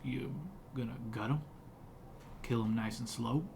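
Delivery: a hint of a gruff woodsman; gruff hermit.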